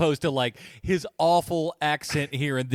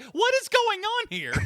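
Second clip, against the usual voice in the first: much higher, incredulous cry; baffled outburst. Retold or imitated. Viewer reacting to the president casting, baffled and high. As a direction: disbelief at the casting. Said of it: "And you're like".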